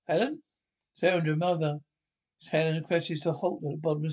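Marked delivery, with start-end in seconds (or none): 0.1-0.4 jolted by the crash, call out; startled. Acted. Husband hearing his wife fall, startled cry.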